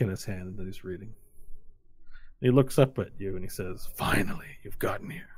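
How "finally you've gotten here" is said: whispery character voice. Acted. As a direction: greet them in a hushed whisper. Game master voices Monsoon's greeting.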